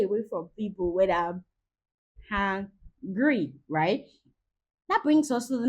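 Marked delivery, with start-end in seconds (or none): 2.3-3.5 "hungry" split as HANG... gree; hangry coinage. Shown as a demonstration. Mother naming her own state, "hungry" pronounced hang-gree. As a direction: draw out "hungry" as hang-gree, two separate beats. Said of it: "we have a word for it".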